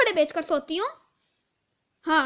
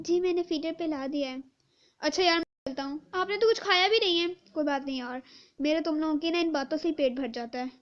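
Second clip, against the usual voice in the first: ordinary delivery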